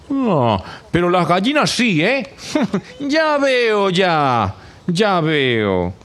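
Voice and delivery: a hint of a high sly cow; knowing, teasing reply; lightly voiced sly cow. Envious cow answering the others, slyly and a bit high.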